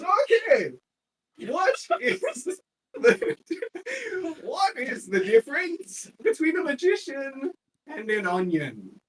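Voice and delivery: high, loud laughing set-up; comedian's joke. Acted. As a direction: cackle through the joke set-up. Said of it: "And he goes".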